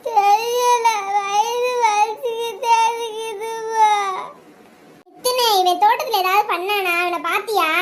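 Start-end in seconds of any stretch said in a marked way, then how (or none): 0.1-3.9 high, slow tearful wail; crying in pain. Acted. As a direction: wail the words out, long and high, to mother. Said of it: "சரி, அழுகாத". (வலியில் உயர்ந்த குரலில் அழுதல்)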